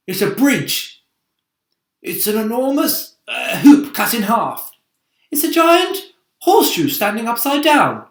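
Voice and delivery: loud high eager describing voice; excited high voice. Acted. A traveller describing the giant arch, loud high eager voice. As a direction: blurt out the guesses.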